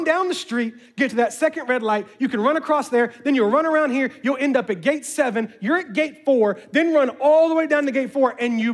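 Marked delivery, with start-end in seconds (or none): none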